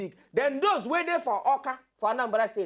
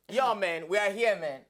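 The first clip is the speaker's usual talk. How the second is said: lightly voiced cool guest; a hint of a laid-back late arrival; casual greeting; 4 semitones lower than usual. Guests strolling into the studio, lightly mocked as a casual greeting. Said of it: "And I say they spread hand".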